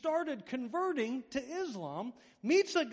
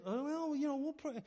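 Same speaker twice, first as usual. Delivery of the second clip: mock-polite higher-pitched dodge; noncommittal brush-off; 7 semitones higher than usual. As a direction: imitate leaders lightly brushing someone off. Mimics leaders' evasive reply.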